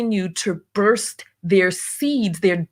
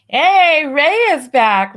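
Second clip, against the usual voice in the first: loud, high happy hello; excited greeting; 6 semitones higher, 5 dB louder, slower than usual. Real, not acted. Host welcomes back a regular donor, loud and delighted. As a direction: greet him with a delighted shout.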